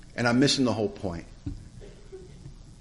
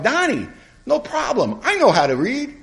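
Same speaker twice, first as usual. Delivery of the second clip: high-pitched, drawn-out mock reassurance; breezy reassurance; 13 semitones higher than usual. Sponsor offering newcomer reading help, breezily reassuring.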